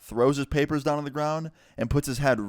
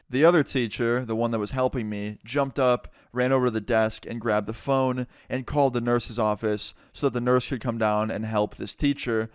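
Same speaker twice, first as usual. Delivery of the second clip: usual speaking voice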